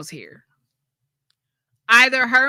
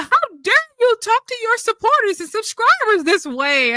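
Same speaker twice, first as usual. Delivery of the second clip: much higher, indignant put-on voice; mock offended woman; 12 semitones higher, 4 dB louder than usual. Mocking the angry commenter's indignant outrage. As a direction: ham up the outraged ex-subscriber. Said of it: "And she was like".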